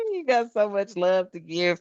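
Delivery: ordinary talk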